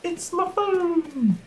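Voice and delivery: high, slow delighted cry; over-the-top phone discovery; excited. Acted. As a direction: exclaim with mock delight.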